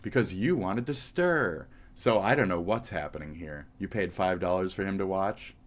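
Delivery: usual speaking voice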